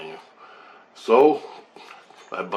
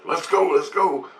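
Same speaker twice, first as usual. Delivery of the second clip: freaking out; 8 semitones higher, 4 dB louder, slower than usual. Retold or imitated. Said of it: "Bo's freaking".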